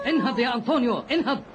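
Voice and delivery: urgent waking. Acted. Old man wakes friend urgently.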